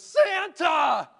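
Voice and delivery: high, loud joyful yell of a name; excited shout. Retold or imitated. Buddy the Elf hearing Santa is coming, ecstatic shout. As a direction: shout it like a thrilled kid in a toy store. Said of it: "Buddy gets really excited. He goes".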